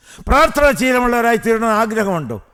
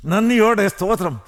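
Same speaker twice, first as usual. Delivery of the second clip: ordinary talk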